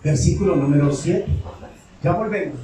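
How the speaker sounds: ordinary talk, nothing marked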